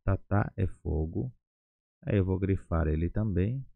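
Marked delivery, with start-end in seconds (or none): 0.0-1.3 "Tatá" slow, both syllables clear, rising on the end; slow pronunciation demo. Shown as a demonstration. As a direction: model "Tatá" slowly for learners. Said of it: "Fogo chamamos de tatá". ("Tatá" devagar, sílabas claras)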